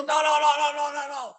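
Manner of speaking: frantic high-pitched no, no, no; panicked no's. Retold or imitated. Magician stops a back pat.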